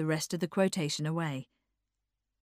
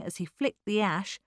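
ordinary delivery, nothing marked

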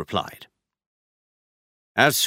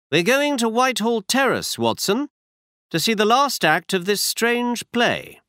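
brisk, higher-pitched detective voice; Holmes voiced higher; 11 semitones higher than usual. A narrator's reading. Holmes tells Watson where they go, higher detective voice. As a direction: voice Holmes up high, assured.

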